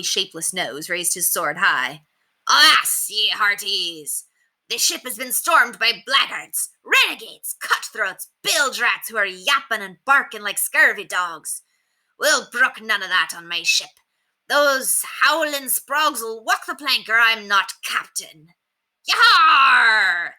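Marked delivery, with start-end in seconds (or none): 2.5-18.5 blustering stage pirate, slower at the end; pirate captain. A narrator's reading. 19.0-20.3 loud roaring yar; pirates' roar. A narrator's reading. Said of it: "the pirates roared".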